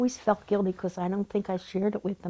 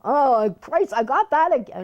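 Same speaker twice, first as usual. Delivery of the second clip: loud, higher-pitched mock whine of protest; kids complaining; 5 semitones higher, 7 dB louder than usual. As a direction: groan it out like an aggrieved kid. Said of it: "hear all the kids complain".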